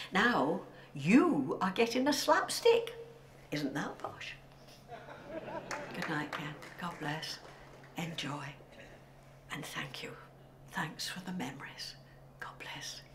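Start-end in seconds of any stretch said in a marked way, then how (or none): 5.9-13.0 soft, fading whisper; whispered goodbye. Real, not acted. Saying goodbye to Ken, whispering softly. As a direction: let the farewell dissolve into a whisper.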